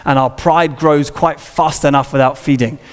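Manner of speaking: ordinary delivery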